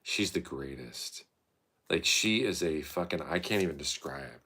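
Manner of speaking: usual speaking voice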